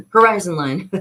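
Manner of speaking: hoarse voice. Real, not acted. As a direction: voice cracking, losing it. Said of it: "I'm losing my voice".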